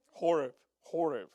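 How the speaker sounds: Horeb with a breathy H at the start. Shown as a demonstration. Pastor repeats Horeb, copying the congregation's H. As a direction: give Horeb a clear English H sound. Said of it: "You gotta do the H there".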